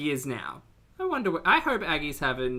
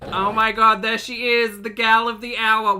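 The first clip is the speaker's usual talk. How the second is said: high, loud gushing welcome; playfully excited; 7 semitones higher, 8 dB louder than usual. Acted. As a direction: hail her like the star arriving. Hailing Aggie's appearance on screen.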